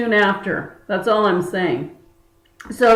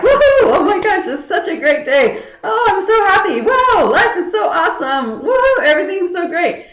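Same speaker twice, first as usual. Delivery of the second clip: giddy morning joy; 9 semitones higher than usual. Retold or imitated. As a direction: bounce off the walls with glee, squealing. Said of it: "I feel so happy".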